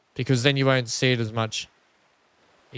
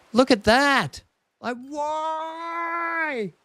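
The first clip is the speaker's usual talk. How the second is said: shocked cry; 14 semitones higher, much slower than usual. Real, not acted. Streamer yelps at his glitching track in disbelief. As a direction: yelp at the broken track in disbelief.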